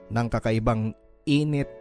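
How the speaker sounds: ordinary talk, nothing marked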